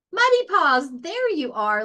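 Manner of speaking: high, loud happy call, finding the puppy; relieved laughing call. A narrator's reading. Ben finds his puppy under the straw, happy laughing call. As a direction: relief bubbling into delight. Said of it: "laughed Ben".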